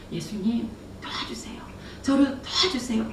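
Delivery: earnest plea. Retold or imitated. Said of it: "간절하게, 간절하게".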